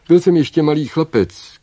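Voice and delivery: high, loud opening of a tale; eager young storyteller. A narrator's reading. Prince tells Baba Yaga his childhood story, ringing and high. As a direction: launch the tale with a ringing start.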